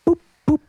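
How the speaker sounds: high little boops; short, high playful pops; poking noise. A narrator's reading. Farmer poking with her little finger, high boops.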